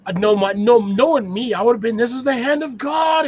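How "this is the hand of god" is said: higher, hammed-up battle cry; playful declaration pitched up high; mock battle cry. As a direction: announce the mighty blow in mock triumph. Imagines himself delivering a slap.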